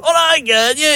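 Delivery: mock cheer. Rugby team cheering.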